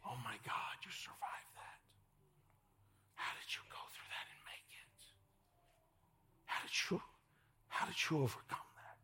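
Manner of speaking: hushed, awed whisper of onlookers. Retold or imitated. Congregation hears onlookers whisper in awe. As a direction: breathe it in wonder.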